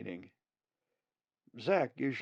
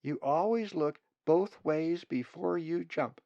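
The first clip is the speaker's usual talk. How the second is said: higher, louder confident chick voice; strong, clear voice; 7 semitones higher, 5 dB louder than usual. Acted. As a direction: recite the answer like the class star. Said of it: "spoke in a strong, clear voice".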